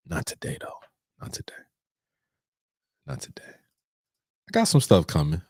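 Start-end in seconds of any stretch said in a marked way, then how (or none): 0.1-3.4 very soft, whispered aside; barely voiced, fading away; hushed whisper. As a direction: drop it to a whisper. Trails into teasing whispered refusal.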